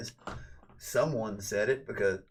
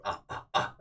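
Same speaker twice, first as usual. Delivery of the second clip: voiceless ape huffs; gorilla grunts. Acted. Streamer mocking wannabe gorillas in a monkey world, gorilla grunts. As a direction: pant out short gorilla hoots. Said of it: "be a gorilla in a monkey world".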